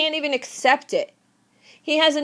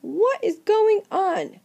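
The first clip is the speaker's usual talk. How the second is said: much higher mock-startled voice; startled Clarabelle; 6 semitones higher, slower than usual. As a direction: squeal in confused surprise. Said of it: "Clarabelle's like".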